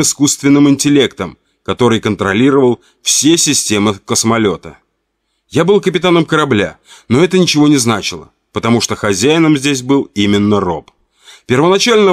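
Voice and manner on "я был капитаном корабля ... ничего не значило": usual speaking voice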